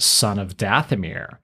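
ordinary delivery

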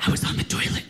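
urgent whispered blurt. Retold or imitated. Mom explaining the peach to her son, urgent whisper. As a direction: hiss it out in a whisper.

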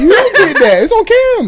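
loud, high laughing callout; laughing shout. Real, not acted. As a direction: yell it through laughter. Teasing callout, laughing loudly.

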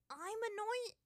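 very high, loud protest; indignant outburst. A narrator's reading. Aang objects to the monkey's insult, outburst. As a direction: fling the accusation back, squawking. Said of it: "cut across his outburst".

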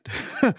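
quick burst of high laughter; short high laugh. Real, not acted. Recalling the author's hostility with a short high laugh.

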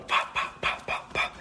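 whispered running footsteps; tiny unvoiced-breath patter of feet; soft sound imitation. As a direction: pop the footsteps out under your breath.

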